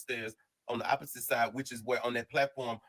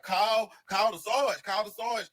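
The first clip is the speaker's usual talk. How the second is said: urgent call; 6 semitones higher, slower than usual. Retold or imitated. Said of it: "So we like".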